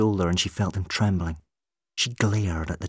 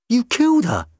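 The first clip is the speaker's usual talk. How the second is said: much higher, louder cry of horror; horrified accusation; 10 dB louder than usual. A narrator's reading. Rose accuses McCavity of the murder, horrified. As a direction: Rose realises the truth, high and shocked.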